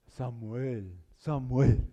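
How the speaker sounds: deep, booming movie God calling. Pretend. Preacher mocking the movie God calling Samuel, booming. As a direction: boom the name like a film God. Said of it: "en las películas, una voz así de".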